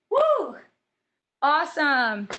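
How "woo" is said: high, bright cheer of triumph; excited whoop. Real, not acted. Coach cheering the class after the jumping jacks, excited whoop. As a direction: let out a victory whoop.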